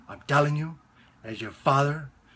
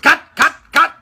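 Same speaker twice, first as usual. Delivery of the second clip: high, loud repeated shouts; director yelling cut; 16 dB louder than usual. Retold or imitated. Director halting the young actor's take, imitated yelling. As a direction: stop the take with sharp yells. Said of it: "And he goes".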